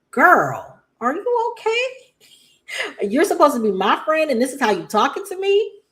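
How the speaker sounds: like you can't believe what your friend just said; high, sassy "girl, are you okay?"; incredulous girl voice. Retold or imitated. Exasperated reaction to Tracy's lecture.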